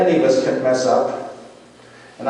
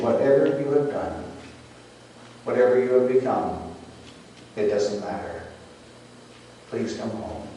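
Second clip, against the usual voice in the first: tender plea; 4 semitones lower, 7 dB quieter than usual. A narrator's reading. Mother inviting her runaway daughter home, tender and hushed. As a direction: softly read loving note, fading low.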